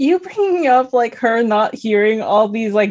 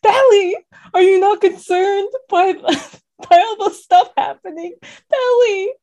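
much higher, imploring voice; mock exasperated plea; 7 semitones higher than usual. Viewer pleading with oblivious Peli, mock exasperation. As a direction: plead in exaggerated disbelief.

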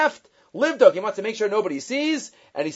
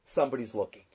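much lower and softer, a grave hush; low quiet reveal to the wagon driver; hushed punchline; 10 semitones lower, 11 dB quieter, faster than usual. Retold or imitated. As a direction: drop the voice low and soft for the punchline.

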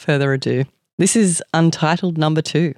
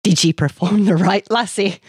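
put-on fervent Scottish-accented question; thick Scottish accent; 5 semitones higher than usual. A narrator's reading. Kilted man questioning Amanda, fervent Scottish accent. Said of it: "he said fervently in a thick Scottish accent".

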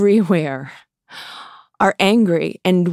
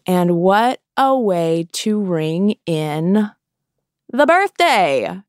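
sarcastic cheer, lingering on each word; slower than usual. Real, not acted. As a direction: stretch it out with sarcastic fanfare. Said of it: "I say that sarcastically".

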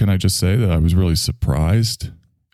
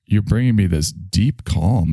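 ordinary delivery

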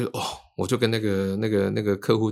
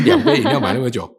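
louder, annoyed phone scold; exasperated scolding; 3 semitones higher, 9 dB louder than usual. Retold or imitated. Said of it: "我说：".

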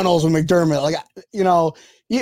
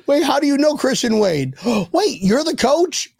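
high mock disbelief with a gasp; hammed-up innocent astonishment; feigned surprise; 9 semitones higher than usual. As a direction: act dumbfounded, as if just finding out. Play-acts not knowing the coach.

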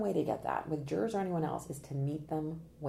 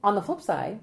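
ordinary delivery

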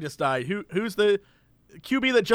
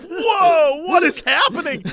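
startled whoa; 8 semitones higher, 4 dB louder, slower than usual. Retold or imitated. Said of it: "You're like".

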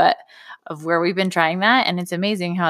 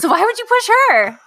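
much higher, louder, pressing the question; exasperated scolding; 6 dB louder than usual. Retold or imitated. Mom demanding why her son pushed his sister, sharp and high. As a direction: make him answer for it. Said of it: "I'm like".

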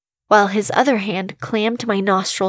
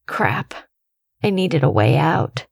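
ordinary delivery

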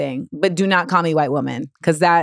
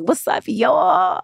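higher, slow laid-back greeting; mock cool kid saying hi; trying to sound down; 7 semitones higher, much slower than usual. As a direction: stretch it out like a hip hop fan. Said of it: "and I'd be like".

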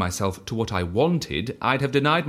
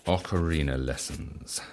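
softer and slow, saying it as he writes; murmured note-taking; 6 semitones lower, 7 dB quieter, slower than usual. Acted. Writing down the other man's wish, quiet slow murmur. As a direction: mutter it to yourself while jotting it down. Repeats the item while writing it down.

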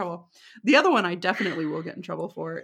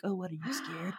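teasing taunt; 2 semitones lower, 11 dB quieter than usual. Retold or imitated. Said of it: "I was gonna try to be funny".

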